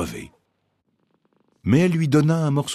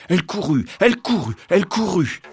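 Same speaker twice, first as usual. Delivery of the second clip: building urgency; 6 semitones higher than usual. A narrator's reading. Repeated running, building.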